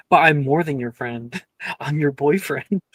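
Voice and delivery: trying not to laugh and failing; can't keep a straight face; breaking into laughter. Real, not acted. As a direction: laugh through the words. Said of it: "I couldn't keep a straight face".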